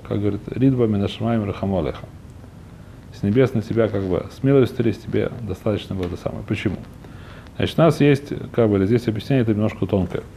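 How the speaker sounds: ordinary delivery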